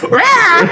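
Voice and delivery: high harsh mock bird screech; featherless parrot squawk. Friend mimics the plucked parrot, squawking. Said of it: "He was like".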